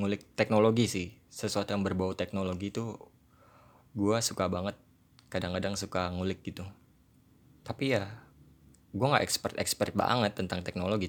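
ordinary talk

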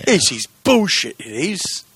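slow, high, hammed-up Cockney grumble; mock Cockney accent. Griping about the European release date, mock Cockney accent. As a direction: grumble like a bad movie Cockney. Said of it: "Cockney-ish".

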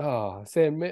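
usual speaking voice

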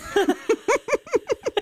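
very high helpless laugh; high squealing laugh. Real, not acted. Mother cracking up at her son's joke, high squeal. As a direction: laugh like it's the funniest thing.

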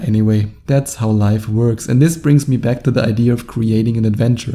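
ordinary talk, nothing marked

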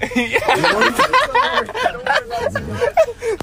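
high shrieking laugh; losing it completely, high-pitched; hysterical laugh. Real, not acted. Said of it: "why are you laughing so hard?".

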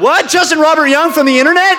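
high, loud mock amazement; mock starstruck shout. Second panelist greets Justin from the internet, starstruck shout. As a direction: cry out in playful disbelief.

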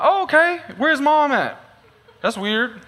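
high, puzzled oh okay; puzzled surprise. Retold or imitated. Eyeing the little boy in line, high puzzled surprise. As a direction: pitch it up, mildly thrown. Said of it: "I'm like".

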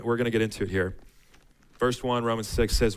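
ordinary talk, nothing marked

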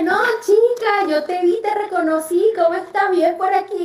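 high, singsong retold reassurance; friendly caller, imitated. Doctor tells her he recognised her, friendly imitation.

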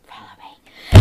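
loud stage whisper. Acted. Bartender beckons party, whispered call.

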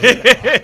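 a loud, high burst of laughter; laughing out hard at the clip; big laugh. Real, not acted. Host bursts out laughing at the viral video, loud laugh.

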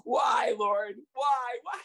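loud, high hammed-up wail to heaven; mock screaming. Coach flops on ground screaming at heaven, mock wail. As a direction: cry out to the Lord in fake anguish. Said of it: "I'd be screaming".

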